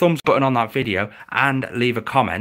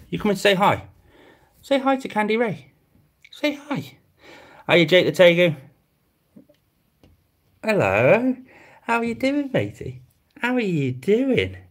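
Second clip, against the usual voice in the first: much higher, cooing pet voice; pet talk; 6 semitones higher than usual. Real, not acted. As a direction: sweet-talk the lizard into coming over. Cooing at Hugo and Jake.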